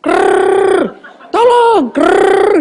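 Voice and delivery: high, loud gurgled krrrr around a strangled tolong; choked gurgle and cry for help; gurgling throat cry. Retold or imitated. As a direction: voice rasping out through a cut throat. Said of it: "Suara keluar dari belakang".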